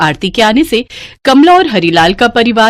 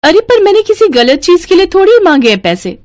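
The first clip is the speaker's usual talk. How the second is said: higher, defensive wife; wife hitting back at her husband, indignant; indignant protest; 10 semitones higher than usual. Acted. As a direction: protest in a raised voice.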